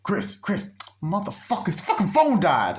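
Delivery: high, urgent panicked call; high-pitched frantic phone rant; frantic friend on the phone. Retold or imitated. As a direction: blurt it out in a rush of alarm. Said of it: "he's like".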